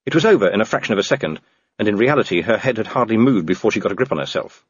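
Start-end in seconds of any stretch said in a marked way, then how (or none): none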